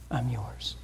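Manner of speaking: soft, dropped-away I'm yours; fading to a hush. Real, not acted. Pastor surrendering before the congregation, hushed and soft. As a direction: let it fall to almost nothing. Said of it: "repeated surrender phrase".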